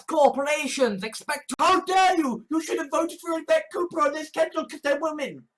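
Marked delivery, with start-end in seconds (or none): 1.6-5.5 high-pitched, hurried indignation; mock scolding. Activist scolds someone over their vote, mock outrage. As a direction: rush out an affronted telling-off.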